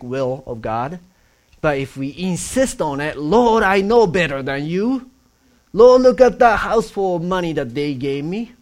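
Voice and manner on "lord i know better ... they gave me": whiny demanding plea. Retold or imitated. Preacher voicing a greedy plea.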